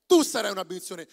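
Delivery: "Tu" thrown out fast and loud; loud preaching. Acted. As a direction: hammer the line home quickly. Repeats the proclamation.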